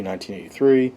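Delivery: ordinary delivery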